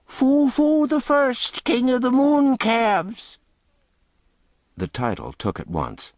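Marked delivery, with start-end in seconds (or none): 0.0-3.4 much higher, louder, slow shrieking mockery; shrieked. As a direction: shriek it out to the crowd. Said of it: "A tinker shrieked out a suggestion".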